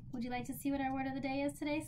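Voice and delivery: ordinary talk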